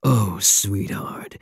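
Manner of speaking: normal talking voice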